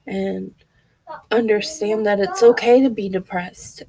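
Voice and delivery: normal talking voice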